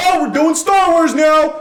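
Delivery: high, loud, slow mock announcement; mock shout. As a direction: yell it out as a mocking imitation of the showrunners. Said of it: "they were like".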